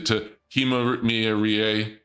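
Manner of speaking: ordinary talk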